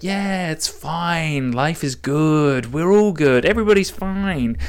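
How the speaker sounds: mock zen after a long run; blissed-out calm. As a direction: drawl out total contentment. Mimics post-run blissed calm.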